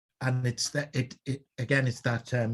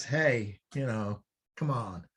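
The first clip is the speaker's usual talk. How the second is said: laid-back American; 4 dB louder than usual. Retold or imitated. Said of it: "And it says".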